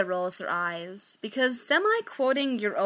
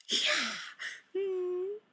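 ordinary delivery

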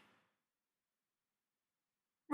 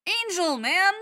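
fanboy gushing; 10 semitones higher, 7 dB louder than usual. Acted. Stiles gushes about the show.

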